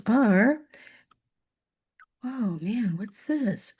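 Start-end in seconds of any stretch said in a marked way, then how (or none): 2.2-3.7 under-the-breath aside to herself; hushed puzzled mutter; quietly wondering what popped up; whispered aside. Real, not acted. Teacher muttering at a slide glitch.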